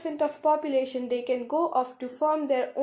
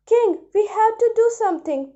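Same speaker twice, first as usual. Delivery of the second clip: high, louder urgent report; alarmed urging; 9 semitones higher, 6 dB louder than usual. Acted. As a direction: raise the alarm to the king. Worried minister alarms the king.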